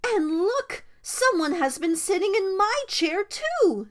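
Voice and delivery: high, fussy mother bear voice; indignant Mama Bear. A narrator's reading. Mama Bear seeing her chair used, indignant. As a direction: exclaim it high and put out.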